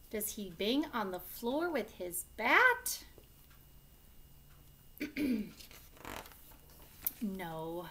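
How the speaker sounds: lively, loud picture-book reading with big swings; teacher reading an angry-dinosaur story to the class, animated; reading to children. Acted. Teacher reads picture book aloud.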